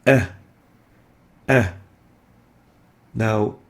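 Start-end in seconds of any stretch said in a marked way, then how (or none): 0.1-1.7 a hamza with choking added; عين حلقية; ع with a choking catch. Shown as a demonstration. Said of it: "throat or glottal letter".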